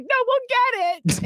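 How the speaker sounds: high, strained mock whine; retold tearful insistence. Mom still insisting to her daughter, tearful. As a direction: re-enact insisting on the verge of tears. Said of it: "And now I'm crying".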